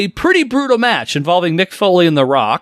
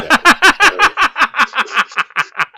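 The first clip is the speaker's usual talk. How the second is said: high laugh; 11 semitones higher than usual. Real, not acted. Laughs at joke.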